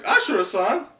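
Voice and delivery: high, loud alarmed call; Kiku's cry. Acted. Kiku crying out to Ashura, loud alarm. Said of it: "Kiku's like".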